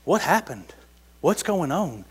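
mild stunned questioning; shaken women. Retold or imitated. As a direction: sound rattled and lost. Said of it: "walk away with their head down going".

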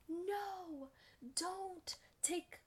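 high, urgent cry of no; panicked plea. Retold or imitated. Wife stopping her husband from the soup, high and panicked. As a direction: rush to stop him, high and frantic.

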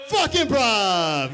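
rally the crowd with a jokey boo; mock rallying shout. Mock rallying cry against improv.

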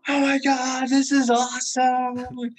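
mock ecstatic scream, loud and high; elated screaming. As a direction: imitate yourself yelling with elation. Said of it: "screaming out of elation".

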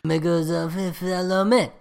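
hammed-up French accent, rising at the end; a silly French ad-man accent, voice lifting at the end of the line; mock French accent. Teasing his cohost about a French director, in a hammed-up French accent. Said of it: "Please stop".

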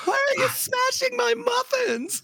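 high, rising mock-manic voice; hammed-up shrill muffin lady at the end; imitating Bill Burr bit. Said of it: "And then he goes".

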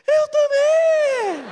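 very high, loud, drawn-out comic shriek; mock terrified scream. Pastor jokingly screams back at the woman, hammed-up fright.

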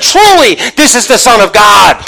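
high, loud proclamation; crying out. Retold or imitated. Preacher voicing the centurion at the cross, crying out. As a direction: shout it as a fervent declaration. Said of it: "he cried out".

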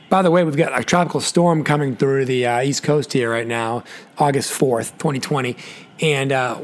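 normal talking voice

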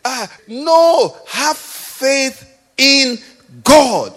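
high, drawn-out words with GOD hit loud; emphatic exhortation. Real, not acted. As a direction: preach it slowly, word by word, landing hard on GOD. Preacher rebukes, urging faith in God.

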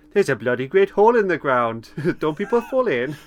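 put-on loud, lilting Welsh accent. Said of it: "it's me doing a Welsh accent".

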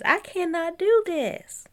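exasperated. Real, not acted. Overwhelmed by the options.

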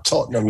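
Tottenham as a quick, local "TOT-num"; not-posh local pronunciation. Shown as a demonstration. British fan coaching the host away from posh, Tottenham as "TOT-num". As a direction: say Tottenham fast, in two unposh syllables. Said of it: "You got to say it like".

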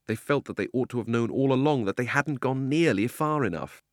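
usual speaking voice

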